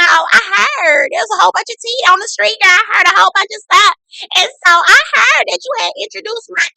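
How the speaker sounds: high, loud whiny accusing voice; dramatic fake hurt; mock Melody. Mimicking Melody confronting Chris about her ex.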